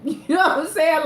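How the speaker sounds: excited laughing aside. Real, not acted. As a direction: laugh through the question. Gushing laugh about Jaden.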